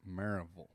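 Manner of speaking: Maryville as MAIR-uh-vul; local pronunciation. Shown as a demonstration. Pastor telling host what they call it, Maryville as MAIR-uh-vul. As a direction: swallow the "y" in Maryville, ending in "vul". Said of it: "They call it".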